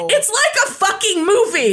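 excited disbelief. Real, not acted. Excited about hiding under boulder.

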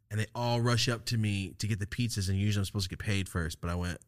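normal talking voice

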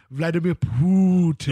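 "Putin" drawn out slowly, opening with a long "poo"; loud, slow "Putin" with a stretched first syllable; fun Putin pronunciation. Retold or imitated. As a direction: stretch "Putin" into a big "Poooo-tin". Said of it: "he calls, what was it".

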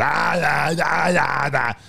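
high, strained impression yells; mock action-star yelling, drawn out; Arnold. Showing how some people do impressions, yelling. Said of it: "when they do Arnold impressions".

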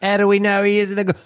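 mock queen voice. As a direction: ham up a posh queen impression. Mock accent riff on the queen.

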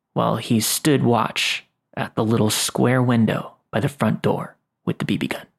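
plain reading voice

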